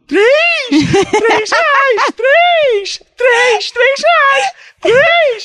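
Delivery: high, loud repeated hawker cry; vendor shout. Retold or imitated. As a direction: chant it like a beach vendor. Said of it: "todo mundo falando".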